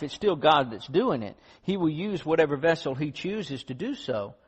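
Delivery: loud, driving preacher voice; fiery sermon delivery; preaching. Acted. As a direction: press the point home from the pulpit. Preaching at full force.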